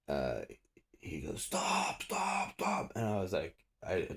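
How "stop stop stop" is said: high, strained mock shout without full volume; imitating officer's yell. Cop ordering the storyteller to halt, imitated high yell. Officer yelling at him in retold arrest.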